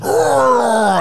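mock yeti roar.

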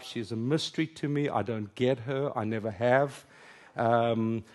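usual speaking voice

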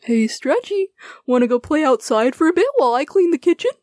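cheerful offer. A narrator's reading. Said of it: "Stilts offered".

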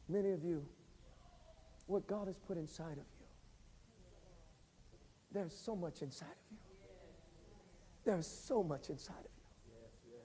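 soft, low preaching over music; hushed preaching. Acted. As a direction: draw the congregation close and quiet.

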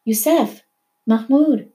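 high anguished calling; crying out. A narrator's reading. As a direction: call out to husband and son. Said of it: "Mahmoud's mother cried".

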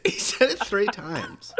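high, slow wheeze of real laughter; loud, drawn-out laughing disbelief; dying laughing. Real, not acted. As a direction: squeal it out through helpless laughter. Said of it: "I was already dying".

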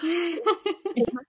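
high startled intake of breath; sharp gasp. Retold or imitated. Hearing the gate camera ping, a hopeful gasp. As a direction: gasp like the doorbell just pinged. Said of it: "I'd be like".

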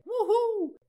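very high, loud falling wuhuu cheer; whoop. Acted. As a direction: cheer like a fan at a concert. Cheer after each patron thanked.

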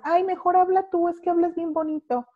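high, coaxing imitation of a shy student; playful high-pitched imitation of a flattering classmate; mock sweet plea. Mimicking Colombians' talk.

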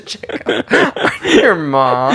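giggly high mock-sultry line; slow, laughing through a fake seductive voice. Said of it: "You have to answer the phone".

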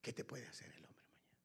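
low whispered question; hushed rhetorical question. Acted. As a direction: ask it in a whisper.

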